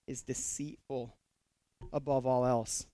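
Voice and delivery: ordinary talk, nothing marked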